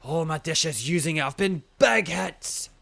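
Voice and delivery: put-on slow, hearty old man in broad Scottish; grand, drawn-out Scottish challenge before battle; Kofu, Scottish accent. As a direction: orate as an old Scottish gym leader, loud line openings. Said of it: "the Scottish accent, yes".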